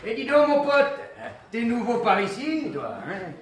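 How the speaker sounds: high, nosy barroom pal; cadging barfly Riri. Acted. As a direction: size up the newcomer. Riri, a cadging drunk worker. (pilier de bar curieux et enjôleur)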